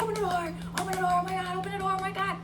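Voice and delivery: high, rapid retold cry for help; panicked pleading. Bitten girl banging on the door for her cousin, panicked. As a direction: re-enact desperate yelling at a locked door. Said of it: "I started banging on the door".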